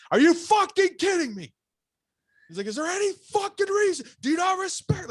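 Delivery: imitating a furious scream, very high and loud. Retelling the boss screaming at the poker table. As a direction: scream it like he's losing it. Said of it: "he's losing it".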